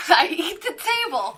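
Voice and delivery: laughing through the words. Real, not acted. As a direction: say it while cracking up. Joking while laughing.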